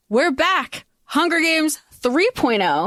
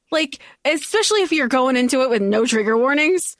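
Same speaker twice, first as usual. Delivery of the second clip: ordinary talk, nothing marked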